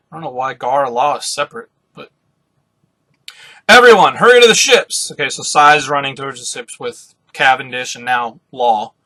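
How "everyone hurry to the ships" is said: raised, loud call to everyone; acted urgency; urgent order. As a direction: shout a rallying order. Urging everyone to flee.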